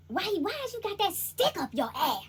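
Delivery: playful mock yell; mock screaming ancestor. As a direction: tease them in a spirit voice.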